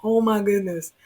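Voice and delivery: ordinary delivery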